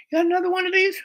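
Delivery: high falsetto imitation of a girl; squeaky mock-girl request; girl's voice. Girl asks for more.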